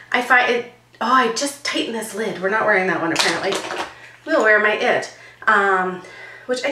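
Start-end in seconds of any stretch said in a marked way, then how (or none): none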